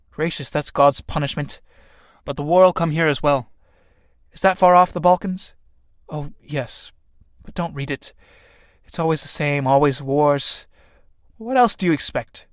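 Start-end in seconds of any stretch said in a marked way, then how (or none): none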